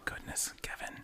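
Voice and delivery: breathy aside at Kevin's handwriting; a half-amused whisper about messy cursive. Real, not acted. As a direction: murmur it under your breath. Reacting to bad handwriting.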